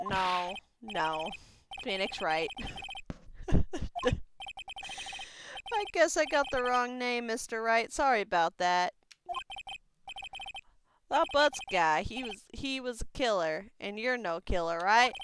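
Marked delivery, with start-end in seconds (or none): 2.5-5.7 high breathy giggling fit; laughter. Real, not acted. Player amused at the game's dialogue, high breathy laughing. Cracks up at the name. 5.7-15.1 lightly voiced Southern detective; folksy detective. Detective warning off an intruder at a crime scene, lightly Southern.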